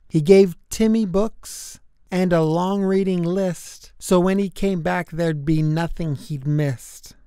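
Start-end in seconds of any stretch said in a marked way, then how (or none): none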